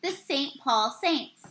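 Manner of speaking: ordinary delivery, nothing marked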